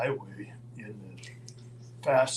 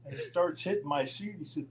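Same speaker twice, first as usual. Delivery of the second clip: ordinary delivery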